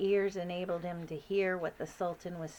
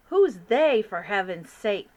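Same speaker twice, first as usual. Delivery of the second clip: loud, impatient outburst; exasperated king; 11 dB louder than usual. Acted. King of Sweden exasperated with the Queen. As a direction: demand an answer crossly.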